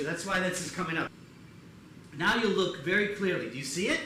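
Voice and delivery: ordinary delivery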